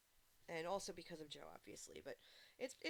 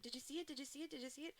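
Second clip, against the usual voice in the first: higher, eagerly repeated question; excited imitation; 7 semitones higher, much faster than usual. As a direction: keep asking, eager for praise. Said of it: "And he's like".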